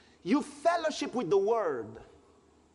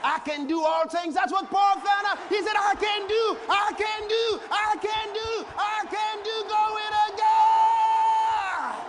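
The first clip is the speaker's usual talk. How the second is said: loud, high, rousing sermon chant; preaching at full force; 9 semitones higher, 4 dB louder than usual. Acted. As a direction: declaim the verse like a revival preacher. Preacher shouting scripture to congregation.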